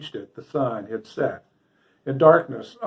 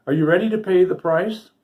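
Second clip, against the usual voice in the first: gaily; 5 semitones higher, 4 dB louder than usual. A narrator's reading. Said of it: "nodding gaily to the dwarf".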